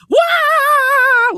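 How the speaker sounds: high, loud, drawn-out mock scream; imitated scream. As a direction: scream like a cartoon hero flying through the air. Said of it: "screaming his ass off".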